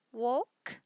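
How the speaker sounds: ordinary delivery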